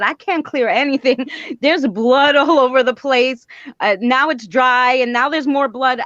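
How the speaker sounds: near-hysterical, mixing laughs and alarm; flipping out. Retold or imitated. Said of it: "I, I was flipping out".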